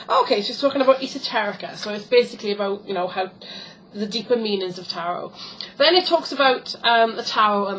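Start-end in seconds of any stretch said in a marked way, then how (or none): none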